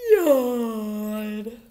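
loud yawn falling away; long, sleepy waking-up yawn; big yawn. A narrator's reading. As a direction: yawn right through the word. Sleepy someone waking in cave.

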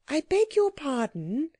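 put-on high, courteous little-girl voice; polite. A narrator's reading. Anthea interrupts the learned gentleman, high polite request. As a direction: lift it into a well-mannered apology. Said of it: "said Anthea politely".

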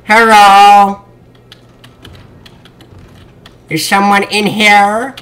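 mock Chinese accent, drawn-out greetings; Jackie Chan accent. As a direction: call out slowly in a hammed-up Chinese accent. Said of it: "I always slip into an accent".

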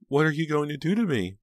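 loud, high-pitched terrified plea; frightened cry. A narrator's reading. Pam to her captor, frightened cry. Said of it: "Pam managed to cry".

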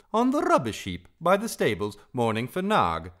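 high, loud singsong bird; Darzee. A narrator's reading. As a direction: sing out the answer airily.

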